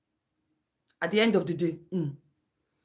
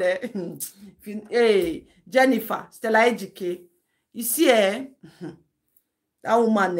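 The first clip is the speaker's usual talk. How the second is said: normal talking voice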